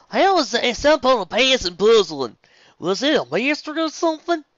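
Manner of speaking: high bright country voice; loud, twangy farm girl. Acted. Applejack character voice.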